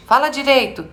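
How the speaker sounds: high-pitched mock reprimand; scolding parent. Adult turns to the child, mock scolding. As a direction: imitate a parent ordering a child. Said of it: "você vira pra essa criança e diz".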